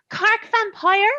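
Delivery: "Cork vampire" slow and high, "Cork" drawn out in a Cork lilt; Cork accent. Retold or imitated. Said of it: "he would talk like this 'cause he's from Cork".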